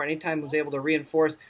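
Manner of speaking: ordinary delivery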